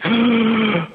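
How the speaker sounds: high sharp gasp, breath finally in; big gasp for air. Retold or imitated. Exhausted runner gasping his first breath. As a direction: gasp like surfacing from water.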